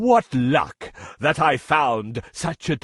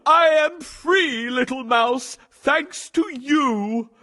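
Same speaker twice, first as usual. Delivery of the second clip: joyful relief; 9 semitones higher than usual. Acted. Lion freed.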